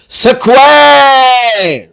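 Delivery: سکوائر drawn out very slowly and loud; teacher modelling سکوائر; slow careful pronunciation. Shown as a demonstration. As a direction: model سکوائر one sound at a time. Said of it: "اس کا تلفظ".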